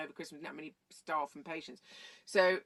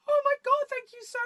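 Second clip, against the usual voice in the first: a sudden high, loud squeal of relief; gushing thank-you shrieked up high; overjoyed thanks; 12 dB louder than usual. Retold or imitated. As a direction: burst out thanking the matron, voice leaping up. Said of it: "I was like".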